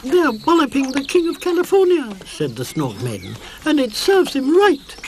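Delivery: high, loud triumphant voice; gleeful. A narrator's reading. Snork Maiden tells Mama about the fight, high and gleeful. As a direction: gloat.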